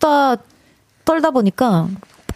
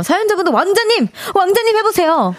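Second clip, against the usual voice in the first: excited; 8 semitones higher than usual. Acted. Reading listener's excited suggestion.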